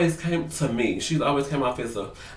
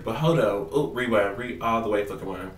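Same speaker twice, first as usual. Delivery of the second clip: ordinary delivery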